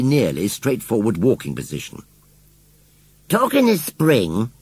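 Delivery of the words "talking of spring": high, drawn-out conversational opener. A narrator's reading. Scarecrow starts a chat with the children, conversational. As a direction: open a chat, pitched up and unhurried. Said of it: "began Gummidge conversationally".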